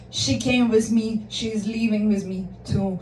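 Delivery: firm claim. Retold or imitated. Randy tells Matt she leaves with him, firm. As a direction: imitate a friend laying down the law. Friend stands up to rival.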